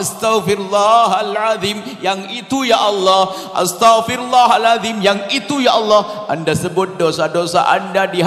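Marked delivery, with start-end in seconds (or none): 0.0-6.3 heartfelt istighfar. Shown as a demonstration. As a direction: drag out each plea for forgiveness. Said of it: "Pastikan Anda sesaat saja Anda menghadap kepada Allah".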